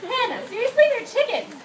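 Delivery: high, loud mock exasperation; teasing her sister for fearing chickens, high and loud; exasperated. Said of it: "and I'm like".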